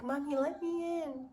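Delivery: high, pleading puppy voice; imitated whining puppy. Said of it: "My poor puppy is whining".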